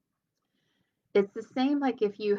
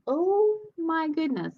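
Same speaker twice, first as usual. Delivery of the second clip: higher, louder, slow stunned oh my goodness; stunned gasp; 10 semitones higher, 6 dB louder, slower than usual. Retold or imitated. Host reacting to a grieving caller, stunned and slow. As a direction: let the shock spill out slowly.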